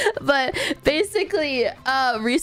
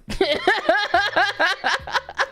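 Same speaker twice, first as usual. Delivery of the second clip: high giggle; 8 semitones higher than usual. Real, not acted. Bursts out laughing.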